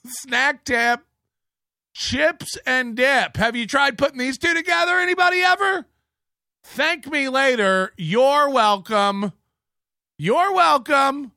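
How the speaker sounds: oversell a trivial discovery; slow, rising mocking hype with a shout; mock excited. Mocking Chad as show-off.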